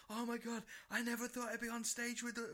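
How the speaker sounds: high-pitched, starstruck mock gushing; imitated gushing fan. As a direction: gush like an overwhelmed fan, high and put-on. Quoting drunk fan after leaving stage.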